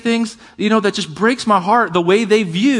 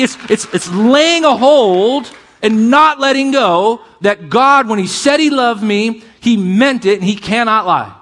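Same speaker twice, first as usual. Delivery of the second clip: higher, louder, building preacher's proclamation; fiery preaching; 6 semitones higher, 4 dB louder than usual. Acted. Pastor assuring his congregation, fiery preaching. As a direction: preach it out with rising fire.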